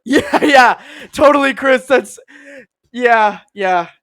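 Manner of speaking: high, loud sycophantic gushing; hammed-up starstruck yeses; over-eager agreement. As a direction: agree far too keenly with the star. Fawning castmates agree with Pratt.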